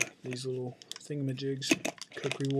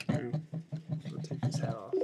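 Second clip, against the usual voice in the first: usual speaking voice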